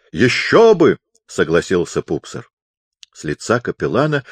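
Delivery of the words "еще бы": loud, high agreement; raised voice. A narrator's reading. Pupser agrees with the deaf chaplain, raising his voice. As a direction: call it back to someone hard of hearing.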